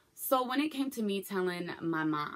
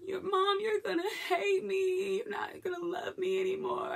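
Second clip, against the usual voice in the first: much higher, sobbing and pleading, slowed down; retold sobbing plea. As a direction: cry the words out through sobs. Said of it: "And I just start bawling".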